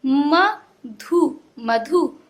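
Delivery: "म", "धु" apart, then "मधु" whole; slow separate sounds resolving into "मधु"; broken, then joined. Shown as a demonstration. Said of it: "तोड़ तोड़ के पढ़ना".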